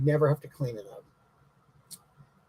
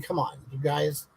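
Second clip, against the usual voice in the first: usual speaking voice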